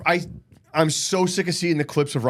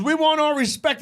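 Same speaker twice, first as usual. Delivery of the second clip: high-pitched imitation of her demand; quoted wrestler's promo; 13 semitones higher than usual. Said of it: "she goes".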